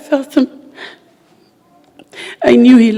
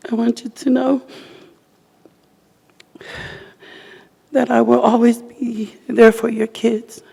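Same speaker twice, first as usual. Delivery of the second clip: higher, strained voice near tears; tearful farewell letter; 5 semitones higher than usual. Real, not acted. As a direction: read the letter to him fighting tears. Reads farewell letter to husband.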